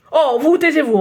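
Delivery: storming. Acted. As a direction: blow up at the supervisor. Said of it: "tempête le photographe". (le photographe tempête contre le surveillant)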